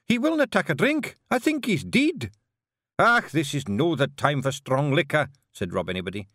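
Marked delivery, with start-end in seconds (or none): 0.0-2.3 higher, louder alarmed cry; frantic Scots wife, overdramatic; mock panic; panicked. Jeanie panics, calling help. 3.0-5.3 a stubborn Scots chieftain, heavy-hearted; lightly voiced gloomy Scottish pixie; Rob Anybody, gloomy Feegle chief. Rob refuses drink.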